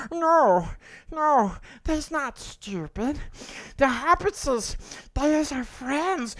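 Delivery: the gentle Smeagol half begging; Smeagol impression. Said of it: "that's my Golem impression".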